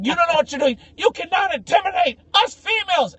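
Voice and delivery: high, loud hammed-up outrage; defiant feminist. Feminist confronting the driver, mock defiant yelling. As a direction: imitate an indignant woman shouting down a man. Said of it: "this feminist came out".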